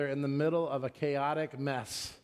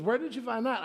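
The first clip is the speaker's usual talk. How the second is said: high-pitched mock challenge; exaggerated clerk's challenge; imitating young clerk; 9 semitones higher than usual. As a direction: mimic the kid's question, pitched well up. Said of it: "That's how he said it".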